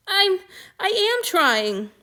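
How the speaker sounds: forcing the words out through tears; crying. A narrator's reading. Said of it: "he was crying".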